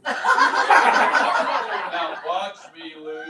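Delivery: very slow, loud, drawn-out words; slow motion. Shown as a demonstration. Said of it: "I'm going slow motion".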